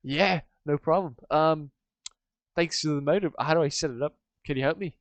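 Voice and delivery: a hint of an animated fisherman character; lightly voiced Stevie. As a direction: play Stevie with a light, lively lift.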